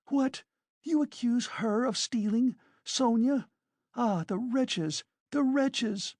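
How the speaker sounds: high-pitched indignant disbelief; scandalised woman, voice pitched right up; outraged cry. A narrator's reading. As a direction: defend her in shrieky outrage. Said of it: "cried Katerina Ivanovna".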